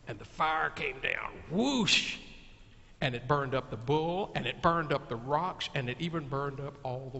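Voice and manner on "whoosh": sweeping whoosh sound. Acted. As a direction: make the fire rush down. Fire comes down from heaven.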